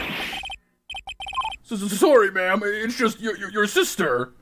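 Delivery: high, stammering nervous guard. Acted. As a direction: stutter it out like a scared guard. Voicing nervous guard character.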